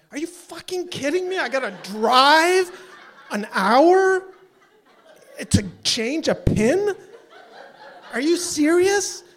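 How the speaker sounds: high, loud, drawn-out disbelief; incredulous outrage. Retold or imitated. Caller's unspoken reply to being sent driving, incredulous outrage. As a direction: stretch it out in rising outrage.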